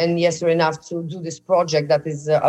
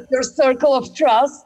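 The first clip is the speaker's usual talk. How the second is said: laughing; 7 semitones higher, 4 dB louder than usual. Real, not acted. Laughing playful suggestion.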